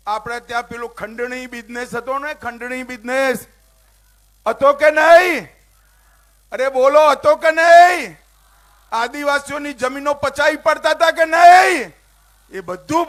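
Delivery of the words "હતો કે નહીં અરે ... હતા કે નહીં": high, loud shout, slowing on the question; shouting to crowd. Real, not acted. Speaker to Bharuch crowd about extortion, shouting.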